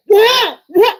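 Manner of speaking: high, loud double what; stunned disbelief; shocked what. Retold or imitated. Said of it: "and I'm like".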